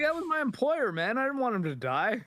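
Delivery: high-pitched parody of a petulant complaint; whiny mock excuse. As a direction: whine it like a sulky kid. Imitating Denji's whiny excuse.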